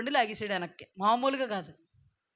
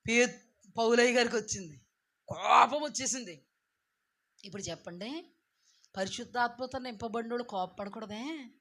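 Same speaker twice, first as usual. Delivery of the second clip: normal talking voice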